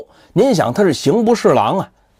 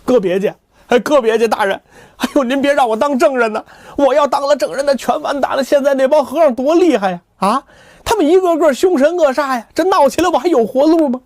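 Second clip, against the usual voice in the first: put-on higher, pleading young man in a fluster; scared Kou pleading with the official, higher voice; panicky young man; 9 semitones higher than usual. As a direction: beg the official not to make you testify.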